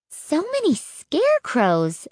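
high-pitched astonished exclamation; exclaiming. A narrator's reading. Child sees the cornfield full of scarecrows, astonished exclaiming. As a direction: exclaim in amazement. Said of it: "I exclaimed".

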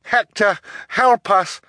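put-on high, loud girl's plea; desperate high-pitched call; calling for help. A narrator's reading. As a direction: call out urgently to an ally. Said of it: "Violet called".

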